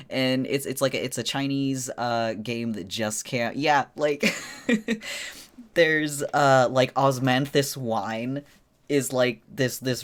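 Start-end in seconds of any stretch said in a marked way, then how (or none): 4.4-5.7 high giggle. Real, not acted. Giggling about hot food character.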